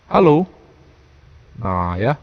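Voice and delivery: processed deep podcast voice; deep podcast effect. Shown as a demonstration. Reviewer demonstrating podcast effect to viewers, deep voice effect. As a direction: explain through a deep broadcast filter. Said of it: "Suaranya jadi dalam".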